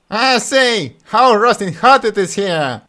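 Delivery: high, loud cheeky fool; bold Butthead. Acted. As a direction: stride in brashly. Butthead addresses princess.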